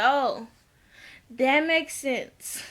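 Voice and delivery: drawn-out, slow knowing reply; slow deadpan. Retold or imitated. Vlogger answering KK's story, slow and dry. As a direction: let it land slowly, dry and knowing.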